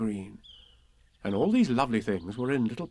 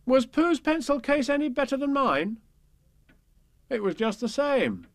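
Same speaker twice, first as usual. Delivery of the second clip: put-on young boy voice; 13 semitones higher, 4 dB louder than usual. Boy's question, narrator reply.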